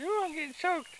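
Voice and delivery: voicing the cat. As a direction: play the cat sputtering in a tiny high voice. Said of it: "He says".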